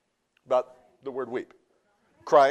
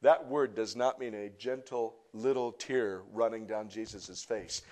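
normal talking voice

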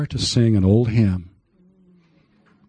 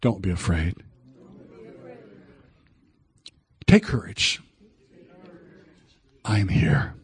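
quiet congregation echo; 4 semitones lower, 8 dB quieter than usual. Shown as a demonstration. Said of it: "say with me".

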